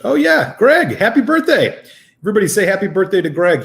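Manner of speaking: high, loud burst of congratulation; excited birthday shout-out. Real, not acted. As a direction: exclaim it as you suddenly remember a friend's birthday. Suddenly remembers a viewer's birthday.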